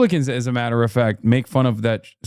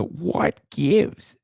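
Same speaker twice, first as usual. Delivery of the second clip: drawn-out Midwestern what gives; slow, savoring the phrase; mimicking the catchphrase; 2 semitones higher than usual. Host mimics candidate's catchphrase, slow Midwestern. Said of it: "she's growing on me".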